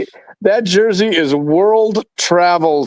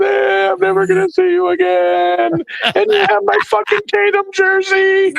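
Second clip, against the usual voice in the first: mock crying. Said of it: "we were on the phone crying".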